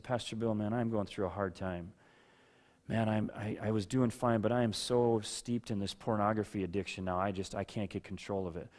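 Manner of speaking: low, subdued admission of struggle; lightly acted troubled confession. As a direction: confess quietly to your pastor, a bit defeated. Imagined congregant confessing to pastor.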